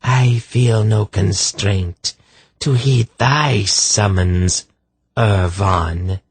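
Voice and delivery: high, slow scornful reply; contemptuous. A narrator's reading. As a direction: draw it out with cold contempt. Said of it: "in a voice filled with contempt".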